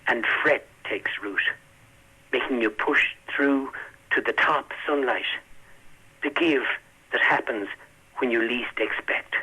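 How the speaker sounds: slow, measured reading of a poem; poetry reading. Acted. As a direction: read it slowly with long pauses. Poet reads his poem aloud.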